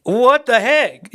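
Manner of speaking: high, slow stunned what the heck; disbelief. Retold or imitated. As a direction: stretch it out in disbelief. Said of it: "And I'm like".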